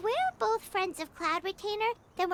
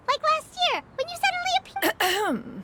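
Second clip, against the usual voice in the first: building, louder and higher; excited; 7 semitones higher, 6 dB louder than usual. Acted. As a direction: get louder as the story grows. Teasing about a past event.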